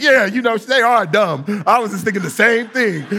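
loud, laughing mock agreement; mock agreeing spouse. Husband joining in on wife's family, loud laughing mock agreement.

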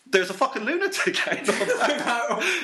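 put-on high laughing voice of the scared old folks; old folks gasping at the lunatic outside, laughing; laughing mock panic. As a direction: squeal it through laughter as a terrified pensioner. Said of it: "just like".

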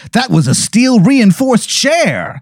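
high, slow indignant wiseguy; Tony Italiano mobster. Acted. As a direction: defend the chair like an offended mob boss. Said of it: "he goes".

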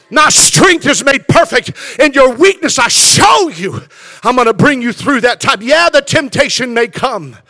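loud, high preaching cry; full-force preaching. Acted. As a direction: preach it out, rising and shouting.

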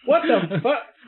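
high, slow, rising bafflement; baffled disbelief. Acted. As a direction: draw it out in utter bewilderment. Acted bewilderment at friend's behaviour.

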